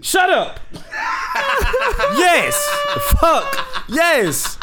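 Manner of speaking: laughing shout. Real, not acted.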